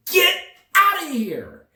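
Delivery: high-pitched yell acting out the moment; retold angry yell. Traveler to people trying to take his bag, retold yell.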